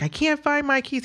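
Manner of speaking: put-on high whiny kid voice; hint of a frantic kid; mimicking a panicked child. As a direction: play the upset son about to melt down. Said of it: "He's about to melt down".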